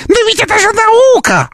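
high squeaky parroting of the opponent; squeaky mocking voice. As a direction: mimic them in a tiny mocking squeak. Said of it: "повторять фразы оппонента своего писклявым голосом".

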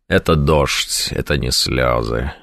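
low, quiet, slow brave voice; stoic hiding tears. Retold or imitated. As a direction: deny the tears with quiet dignity. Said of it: "Вот таким людям хочется помогать". (низко и тихо, мужественно скрывая слёзы)

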